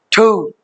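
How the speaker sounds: "ठू" with an aspirated th, anglicized. Shown as a demonstration. A teacher mocking English-speakers, "ठू" aspirated. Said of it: "अब इसको ठू बना दो".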